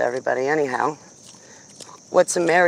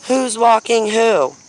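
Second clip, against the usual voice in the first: higher, louder and slow, a sarcastic mock of other people; mocking quote; 5 semitones higher, 4 dB louder, slower than usual. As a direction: drag it out like an annoying know-it-all. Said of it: "I hate when people say to me".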